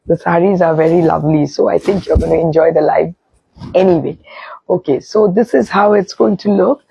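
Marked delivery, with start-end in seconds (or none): none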